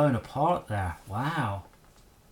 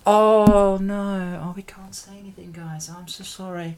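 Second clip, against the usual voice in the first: mock apology; 5 semitones higher than usual. Sarcastic apology to chat.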